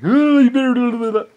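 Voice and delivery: high, loud mocking goad; mock taunt. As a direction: goad in a shrieking put-on voice. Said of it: "Rosalie like".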